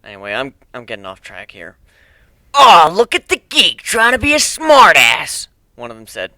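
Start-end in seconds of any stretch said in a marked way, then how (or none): 2.5-5.5 loud, high, slow mocking taunt; sneering bully. Bully jeering at the geek, loud slow mocking sneer. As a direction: jeer at him like a schoolyard bully.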